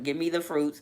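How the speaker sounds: ordinary talk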